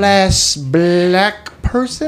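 high, slow hammed-up British accent; mock British accent. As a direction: stretch it out in a silly posh British accent. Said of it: "speaking in a British accent".

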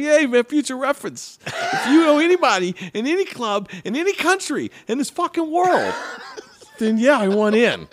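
very high, loud, laughing rant; laughing excited shout. Retold or imitated. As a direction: yell it through laughter, thrilled. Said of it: "And I'm like".

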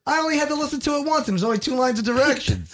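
high, exasperated protest; worked-up high comic yelling. Real, not acted. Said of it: "There's so much yelling".

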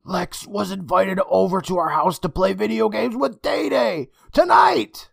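horror building at the last words; shaky horrified realization. Acted. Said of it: "Emma murmured as she shook".

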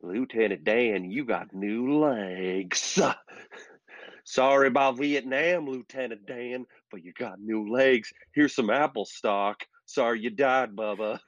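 slow, lightly voiced Gump drawl; Forrest Gump quote. Cohost quoting Forrest Gump to Dan, lightly voiced. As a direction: quote the movie in a mild Gump voice. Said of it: "this is movie talk".

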